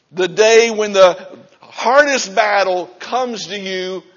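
loud, driving sermon build; full-force preaching. Real, not acted. Preacher urging the church to be strong, at full force. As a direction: preach it hard to the congregation.

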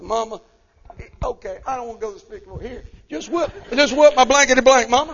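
put-on high, fast child's plea rising to loud; begging little boy. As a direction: plead with mother to just spank you.

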